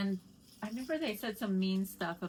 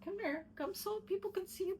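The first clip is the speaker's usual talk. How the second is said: pet talk; 13 semitones higher than usual. Real, not acted. Calling the dog over.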